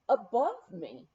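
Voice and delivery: usual speaking voice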